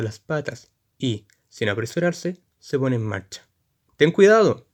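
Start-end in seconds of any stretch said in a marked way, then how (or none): 4.0-4.7 grito furioso y agudo; grítale con rabia al cochero; grito con cólera. Acted. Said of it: "grita otro cochero invisible con cólera". (grito colérico)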